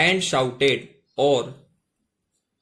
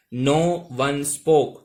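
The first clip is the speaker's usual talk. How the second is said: slow model reading; much slower than usual. Shown as a demonstration. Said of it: "no यानी".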